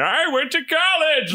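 high, slow cartoon villain yell; Plankton voice. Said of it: "constantly yelling that".

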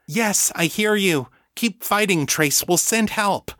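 high, urgent encouragement. A narrator's reading. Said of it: "she sent back urgently".

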